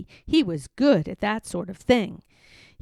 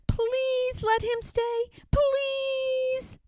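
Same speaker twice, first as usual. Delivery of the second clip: high, slow, drawn-out begging; children pleading; 13 semitones higher, 3 dB quieter, much slower than usual. Acted. Children begging the nurse to keep George, high and slow. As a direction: whine it like a chorus of kids. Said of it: "the other children chimed in".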